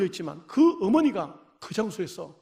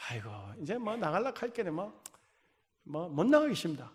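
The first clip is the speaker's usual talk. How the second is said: much softer, giving up; resigned sigh; 2 semitones lower, 8 dB quieter than usual. Retold or imitated. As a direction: trail off in resignation. Resigned reply retold. (교회 못 나간다고 체념하는 어머니의 작고 힘없는 대답)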